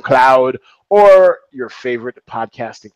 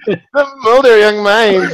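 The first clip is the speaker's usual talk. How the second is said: laughing; 5 semitones higher than usual. Real, not acted. Repeats title laughing.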